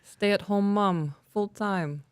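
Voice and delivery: slow, drawn-out attempt at a British accent. Reading a listener email to her partner in a slow British accent. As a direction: read it slowly in a put-on British accent. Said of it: "could I do a British accent?".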